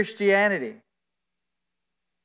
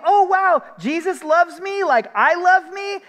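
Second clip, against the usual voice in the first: mock self-delight; 10 semitones higher, 3 dB louder than usual. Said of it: "And then you think".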